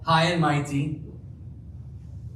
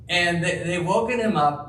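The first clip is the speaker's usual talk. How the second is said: normal talking voice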